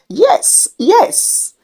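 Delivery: high, eager yes yes. A narrator's reading. The pastor agrees eagerly to the sorcerer, high and eager. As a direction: jump at the offer. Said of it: "said enthusiastically".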